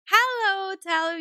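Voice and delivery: bright, high and loud hello to a viewer; excited greeting. Real, not acted.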